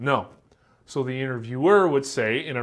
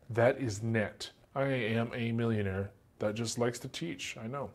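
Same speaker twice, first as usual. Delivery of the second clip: deadpan joke; 3 semitones lower, 10 dB quieter than usual. Real, not acted. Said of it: "joking about millionaire".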